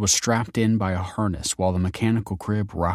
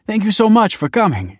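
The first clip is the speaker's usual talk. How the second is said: gushing; 8 dB louder than usual. Acted. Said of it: "she gushed".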